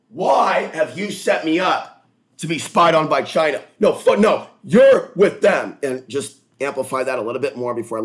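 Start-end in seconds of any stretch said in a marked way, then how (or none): 0.1-5.8 loud, high, drawn-out accusing yell; raised-voice outburst, acting out an upset rant; re-enacted screaming accusation. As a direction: scream it out as if betrayed. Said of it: "so I start screaming".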